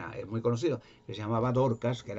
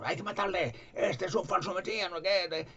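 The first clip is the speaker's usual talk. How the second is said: accusers demanding death; 7 semitones higher than usual. Retold or imitated. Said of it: "le calumniaban".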